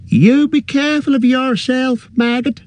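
high calling voice of a farmer's wife. A narrator's reading. Wife calls to her husband at the door, high calling voice. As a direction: call after him from the lit doorway. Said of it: "she called".

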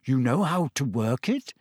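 guarded, rising question; wary question, voice held back; suspicious. A narrator's reading. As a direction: ask it doubtfully, ending on a rise. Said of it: "Harry said suspiciously".

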